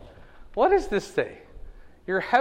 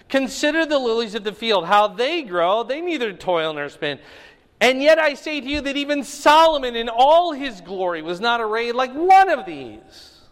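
scripture reading; 6 semitones higher, 5 dB louder than usual. Acted. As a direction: proclaim the verses to the room. Reads scripture aloud to class.

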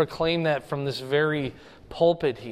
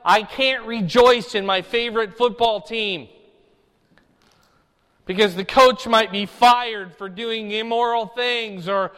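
louder, higher, fervent sermon delivery; full preaching; 8 semitones higher, 8 dB louder than usual. Acted. Pastor urging the congregation to rejoice, preaching at full force. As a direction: drive the point home from the pulpit.

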